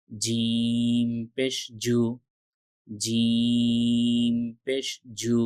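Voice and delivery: very slow, each syllable drawn out like a chant; slow recitation drill. Shown as a demonstration. Teacher reciting Arabic letters slowly for students to repeat. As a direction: stretch every letter name slowly for the learners.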